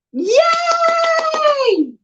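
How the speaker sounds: very high squealed yay; cheer. Real, not acted. Owner cheers about the doll with a high squeal.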